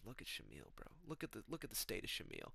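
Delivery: very soft, imitated murmur; hushed aside. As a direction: say it under your breath to a friend. Friends secretly pointing out stoned Shamil.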